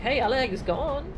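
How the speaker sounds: ordinary talk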